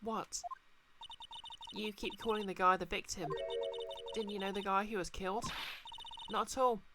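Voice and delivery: chirpy policewoman turned waitress; cheerful young woman voice. Acted. Player voicing Maggie dialogue.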